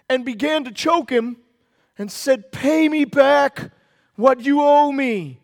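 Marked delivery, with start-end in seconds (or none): 2.5-5.4 loud, slow, insistent demand; forceful demand. Acted. Servant choking his debtor for repayment, forceful demand. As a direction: demand it hard, word by word. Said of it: "he demanded".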